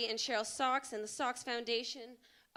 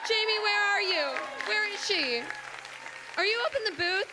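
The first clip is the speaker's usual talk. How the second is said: loud, high call across the theater; 10 semitones higher, 8 dB louder than usual. Real, not acted. Host calling across the theater for Jamie, loud and high. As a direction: shout to find her in the booth.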